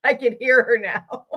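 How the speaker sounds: words spoken through a real laugh. Real, not acted. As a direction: chuckle fondly as you say it. Laughing after imitating grandma.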